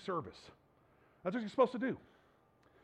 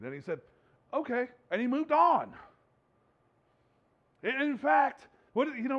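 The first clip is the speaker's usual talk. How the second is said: loud, high, driving sermon voice; preaching at full force; 5 semitones higher, 6 dB louder than usual. Acted. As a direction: drive it home to the congregation.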